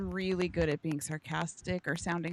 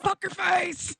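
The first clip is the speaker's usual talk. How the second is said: angry snap; 10 semitones higher, 4 dB louder than usual. Pretend. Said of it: "But if you piss me off".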